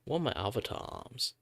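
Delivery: ordinary talk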